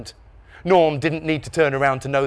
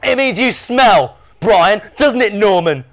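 high, loud, drawn-out jeer; taunting little brother; 8 semitones higher, 8 dB louder, slower than usual. A narrator's reading. Dave insulting his brother Brian, taunting. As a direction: jeer like a squabbling kid.

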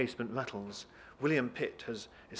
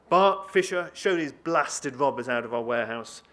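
angry shouted order; 2 semitones higher, 9 dB louder than usual. Acted. Deputy clears the warehouse of robbers, angry shouting. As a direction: bellow orders at your men.

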